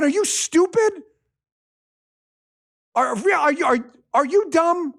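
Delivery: are you stupid, incredulous and heated; exasperated outburst. Real, not acted. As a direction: hammer them with disbelief. Exasperated rant at critics.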